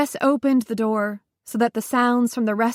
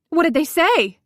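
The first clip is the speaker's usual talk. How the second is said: high and loud, near a shout; almost shouting; 7 semitones higher, 5 dB louder than usual. A narrator's reading. Widow pressing her friend for answers, almost shouting. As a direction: push the question out, nearly shouting. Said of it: "was aware that she was almost shouting".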